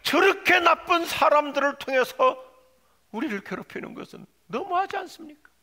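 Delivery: loud, high protest to God; Habakkuk. Acted. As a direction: argue indignantly at full voice, stretching the words. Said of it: "하박국 선지자는 근원적인 항변을 하는". (큰 소리로 따져 묻듯 항변)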